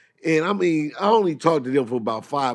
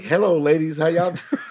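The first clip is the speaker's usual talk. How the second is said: polite phone greeting; 6 semitones higher, 4 dB louder than usual. Retold or imitated. Acts out his too-polite phone greeting.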